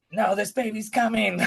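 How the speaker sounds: high-pitched imitation of an insistent labouring wife; urgent protest. Wife contradicts doctor during labour.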